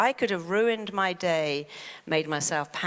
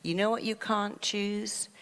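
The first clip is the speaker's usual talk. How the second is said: ordinary delivery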